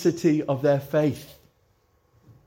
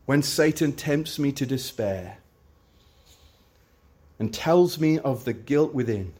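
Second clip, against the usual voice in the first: hymn recitation. Acted. Recites hymn verses slowly.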